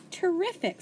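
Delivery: bright high terrific; pleased teacher beaming; delighted. A narrator's reading.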